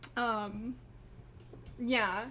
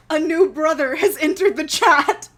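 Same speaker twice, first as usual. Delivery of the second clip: loud joke announcement; 3 semitones higher, 14 dB louder than usual. Real, not acted. Joking announcement of new brother.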